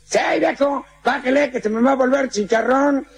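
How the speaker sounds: put-on high Mexican-accented shout up the building; hammed-up Mexican yelling upward; Mexican calling up. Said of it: "mira para arriba".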